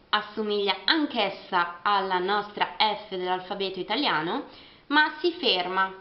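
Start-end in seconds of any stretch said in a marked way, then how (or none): none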